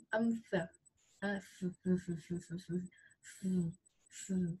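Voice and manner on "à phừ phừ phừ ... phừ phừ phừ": soft, repeated "phừ" puffs without throat buzz; short "phừ" bursts, all air; voiceless airy consonant. Shown as a demonstration. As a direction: blow "phừ" out with no vibration. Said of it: "Không được rung nhá".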